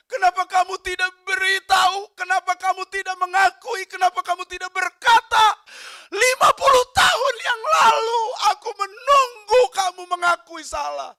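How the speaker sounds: high, loud, tearful wail; husband crying out. Retold or imitated. Weeping husband pleading with his dying wife, loud and tearful. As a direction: cry out fifty years of waiting.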